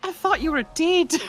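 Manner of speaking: loud, very high mimicked wail; distraught woman. Echoing the woman with her horse, loud high wail. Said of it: "the woman is goes".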